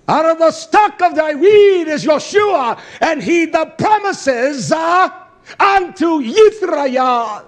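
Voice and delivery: high, loud, slow preacher's cry; preaching at full force. Acted. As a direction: lift the scripture to a shouting climax. Preacher climaxes reading scripture.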